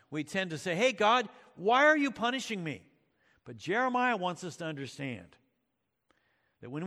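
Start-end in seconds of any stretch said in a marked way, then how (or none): 0.8-2.8 higher, louder whining plea; a hammed-up protest, higher and louder; mock complaint to God. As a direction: cry out at God like a wronged child.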